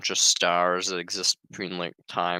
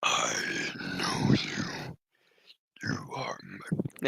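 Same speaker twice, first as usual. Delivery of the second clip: low, slow, rasping whisper; creepy star whisper; 10 semitones lower, 3 dB quieter, much slower than usual. Acted. Reading the creepy star person's line, raspy low whisper. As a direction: breathe it like an alien thing that knows him. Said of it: "I can't do that voice very much".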